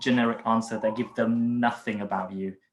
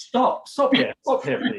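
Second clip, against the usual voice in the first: much higher, louder playful plea to halt; laughing interruption; 12 semitones higher, 5 dB louder, slower than usual. Pretend. Interviewer halting a perfectionist answer, laughing interruption. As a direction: break in, half laughing, and cut them off.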